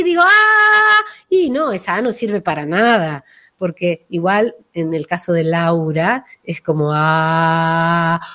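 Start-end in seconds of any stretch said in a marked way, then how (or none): 0.2-1.0 a high, loud, strained "Ah"; shrill tense ah. Shown as a demonstration. Voice coach demonstrating the useless strained vowel, high and loud. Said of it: "tengo la voz así". 6.9-8.2 heart-centred ah. Shown as a demonstration. Voice coach demonstrating the heart chakra vowel, lower. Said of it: "una A realmente de corazón".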